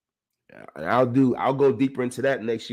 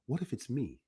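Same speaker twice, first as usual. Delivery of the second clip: hushed inner voice, much softer; half-whispered worry to himself; quiet self-questioning murmur; inner doubt; 13 dB quieter than usual. Retold or imitated. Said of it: "But then I'm thinking to myself".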